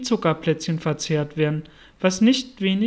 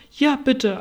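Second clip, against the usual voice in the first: ordinary delivery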